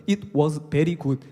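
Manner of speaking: "It was very good" said very slowly with exaggerated rolled sounds; mock over-rolled American English; rolling English. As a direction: overdo the buttery English on "very good". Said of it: "막 굴러가는데".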